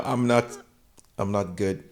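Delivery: normal talking voice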